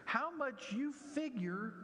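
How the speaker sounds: high, lively Bible teacher voice; storytelling teacher. Retold or imitated. Bible class teacher telling the bear story, lively and high. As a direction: ham up the excited teacher. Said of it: "a Bible class teacher who was telling the story".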